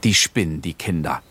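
half-serious mock despair; gespielte Verzweiflung. A narrator's reading. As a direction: lament the children, only half meaning it. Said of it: "halb in gespielter Verzweiflung". (halb ernste, gespielte Verzweiflung)

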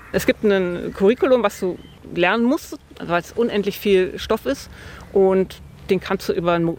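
normal talking voice

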